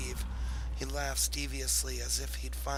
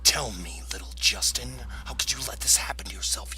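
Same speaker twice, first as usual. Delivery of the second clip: loud rasping whisper, fast at the end; villain whisper; 4 semitones lower, 5 dB louder than usual. Acted. Velander taunting his former student Justin in a whisper. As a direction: hiss it at the old student. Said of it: "Velander says".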